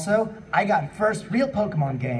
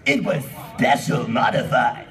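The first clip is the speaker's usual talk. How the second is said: lower, a strange put-on voice; odd voice; 5 semitones lower, 3 dB louder than usual. Umbreon explains its power, in a strange lower put-on voice. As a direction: mangle the voice for Umbreon. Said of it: "what kind of voice is that".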